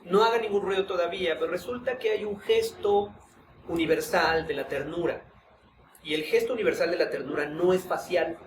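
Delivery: ordinary talk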